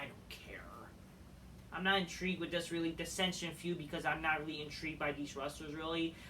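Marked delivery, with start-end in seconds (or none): 0.0-0.6 much softer breathy whisper. Real, not acted. Bored fan muttering about the feud, whispered. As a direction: breathe it out dismissively.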